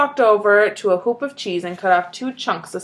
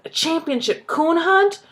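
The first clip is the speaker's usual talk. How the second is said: "hunt" rising high in disbelief; surprised question; 8 semitones higher than usual. A narrator's reading. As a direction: ask it amazed, voice climbing on "hunt". Wide-eyed.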